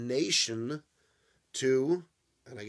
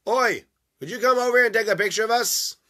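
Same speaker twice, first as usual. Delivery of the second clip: calling request; 9 semitones higher, 10 dB louder than usual. Retold or imitated. Strangers asking for a photo.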